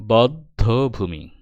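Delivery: বদ্ধভূমি very slowly, bod... dho... bhumi; slow, careful model of বদ্ধভূমি; pronunciation demo. Shown as a demonstration. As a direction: stretch and separate the syllables of বদ্ধভূমি. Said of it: "দেখো উচ্চারণ".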